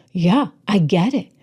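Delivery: usual speaking voice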